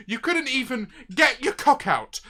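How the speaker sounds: ordinary talk, nothing marked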